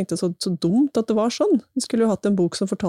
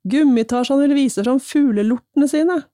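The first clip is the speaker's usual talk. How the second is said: higher storybook jeer; jeering shout; 7 semitones higher, 4 dB louder than usual. A narrator's reading. Bullies mocking Ivan before the gang, raised jeering read. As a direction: call out the mockery as a reader voicing a bully. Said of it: "ropte noen av dem".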